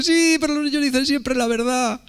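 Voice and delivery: imitating the distraught girl, high and weepy; tearful German girl. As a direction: wail it high as if close to tears. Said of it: "estaba como llorando".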